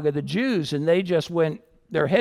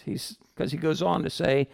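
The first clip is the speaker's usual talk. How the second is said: ordinary delivery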